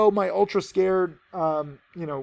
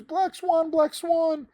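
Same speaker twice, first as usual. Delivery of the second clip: high, whiny-style imitation of excuse-makers; mocking excuses; 10 semitones higher, slower than usual. Trader imitating short sellers making excuses, mockingly high. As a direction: mimic their excuse in a much higher voice. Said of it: "will make all sorts of excuses".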